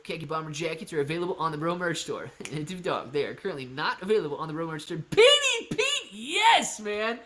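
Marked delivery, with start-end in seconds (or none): 5.0-7.2 big excited yell, high and loud; hyped shout. Real, not acted. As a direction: fire up the chat. Hyped crypto stream shoutout.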